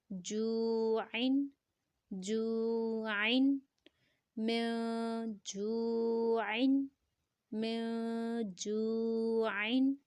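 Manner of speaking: "جو" drawn out long and level before "عین"; elongated recitation. Shown as a demonstration. Teacher models the joined words for learners, elongated recitation. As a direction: recite "جو عین" very slowly, stretching the vowel. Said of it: "دو second تک لمبا کریں گے".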